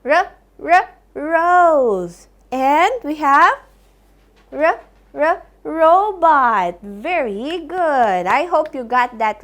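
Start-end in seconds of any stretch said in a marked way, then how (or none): 0.1-2.3 r, r, then rose; phonics sounding out. Shown as a demonstration. Teacher gives the right answer, sounding out rose. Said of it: "These are the right answers". 4.5-6.8 r, r, then robot; phonics sounding out. Shown as a demonstration. Teacher gives the right answer, sounding out robot. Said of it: "These are the right answers".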